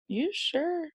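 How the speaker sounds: soft, high questioning lilt; gently probe, voice lifting high; imitating a sceptical coworker; doubtful question. Coworkers doubt her excuse.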